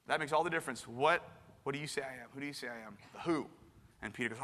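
usual speaking voice

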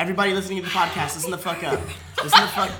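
laughing, fast, loud call to listeners; loud announcement. Acted. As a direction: bark it out like a rowdy announcer. Mock announcement to podcast listeners.

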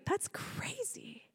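slow, airy mock whisper; whispered agreement. Girlfriend agreeing with boyfriend about the dog, whispered agreement. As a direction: whisper it as if conceding.

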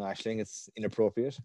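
ordinary delivery

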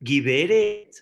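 loud, pointed call; Menahem addressing the wife. Retold or imitated. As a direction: call her to attention, imitated. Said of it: "Menahem le dice a mi esposa".

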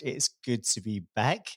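usual speaking voice

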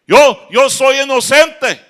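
high, loud, slow protest of innocence; pleading prisoner. Acted. Prisoner begs the duke, insisting loudly he's innocent. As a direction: whine your innocence to the duke.

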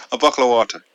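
put-on Irish accent, stronger, fast and through a laugh. Showing off his Irish accent again, laughing, to his co-host. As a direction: rattle off buckle of water in a cheeky Irish accent. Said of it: "that second round was better".

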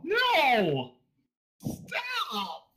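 high squealing protest; embarrassed shriek. Real, not acted. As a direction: squeal no in flustered disbelief. Embarrassed by "adorable.

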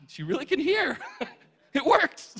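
high, loud astonished cry; hammed-up giddy amazement; amazed outburst. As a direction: shout it as if you can't believe it. Acted amazement at the healing.

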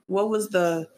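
ordinary delivery

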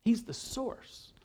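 breathy whispered aside. Real, not acted. Preacher to congregation, slow whispered emphasis.

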